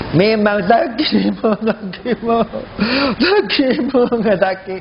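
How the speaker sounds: slow, drawn-out groaning words; hammed-up pain with gasps; mock labour pain. Acts mother groaning in labour pain.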